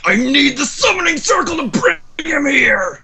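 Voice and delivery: high-pitched, strained mock scream; hammed-up scream. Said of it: "scream inside his head".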